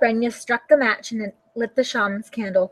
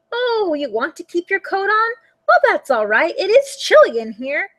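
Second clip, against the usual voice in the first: lightly voiced old Baba, sweet and welcoming; a hint of a delighted old granny at the door; old woman's voice; 8 semitones higher than usual. A narrator's reading. Old woman greeting her guest at the door, sweetly.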